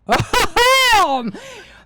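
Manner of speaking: loud high laugh bursting out; high burst of laughter. Real, not acted. Laugh before triumphant quote.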